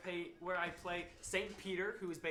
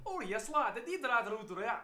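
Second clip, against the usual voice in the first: put-on botched Russian accent; 5 semitones higher, 6 dB louder than usual. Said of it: "I'll walk up as Santa Claus".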